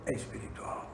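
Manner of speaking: hushed. Retold or imitated. Doctor quietly tells the weeping patient it is spiritual, hushed. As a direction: say it low like a secret.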